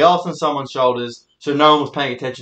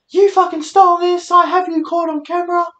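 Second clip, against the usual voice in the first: high-pitched imitation of a yelling guard; mock yelling. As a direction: screech it like an outraged old security lady. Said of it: "yelling at this young guy".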